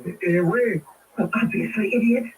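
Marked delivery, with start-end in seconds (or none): none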